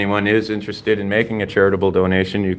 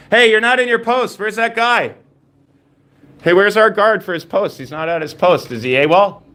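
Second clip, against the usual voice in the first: taunting; 9 semitones higher than usual. Real, not acted. Taunting absent guard.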